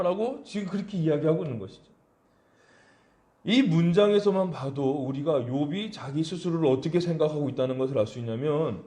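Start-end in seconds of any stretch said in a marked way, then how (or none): none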